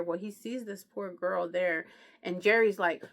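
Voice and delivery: ordinary talk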